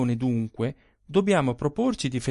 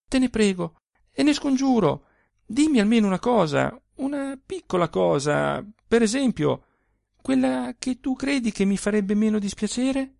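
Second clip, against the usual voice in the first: higher, eager begging voice; insistent pleading; 7 semitones higher than usual. A narrator's reading. Husband coaxing his wife to confess, higher pleading voice. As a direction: wheedle her, pitch raised and coaxing. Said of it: "insistette lui".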